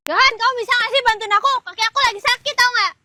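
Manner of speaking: loud, fast complaint; angry demand. Acted. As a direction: demand help in exasperation. First take of quarrel, she angry.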